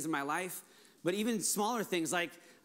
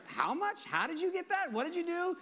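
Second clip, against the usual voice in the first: high astonished questions; amazed questioning; 11 semitones higher than usual. Retold or imitated. Pastors asking how the church got so much, amazed and high. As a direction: imitate other pastors in disbelief.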